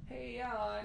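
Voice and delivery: high, soft, off-mic voice; distant background guy. Retold or imitated. Mocking the podcast guy in the background, faint off-mic voice. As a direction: sound far from the microphone. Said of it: "you hear the guy in the background".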